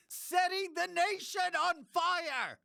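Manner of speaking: big, high-pitched announcer flourish; over-the-top podcast hype; hyped show intro. Acted. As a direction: sell it like a tagline, voice soaring.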